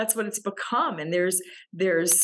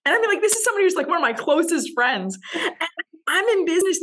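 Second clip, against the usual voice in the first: much higher and louder, incredulous; higher, louder indignant disbelief; indignant outburst; 10 semitones higher, 5 dB louder, faster than usual. Real, not acted. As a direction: blurt it out in disbelief. Indignant telling of friend's remark.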